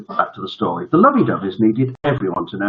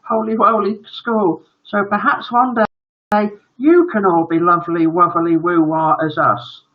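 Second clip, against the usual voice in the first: put-on high baby-talk child voice; gushing little boy; 12 semitones higher, 3 dB louder than usual. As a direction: proudly gush like a sugary son. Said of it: "added Master Lovey-Dovey".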